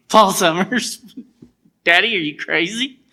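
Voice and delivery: buddy mocking his father's prediction, laughing loud; laughing disbelief. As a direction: laugh it off as absurd.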